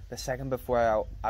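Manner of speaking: plain reading voice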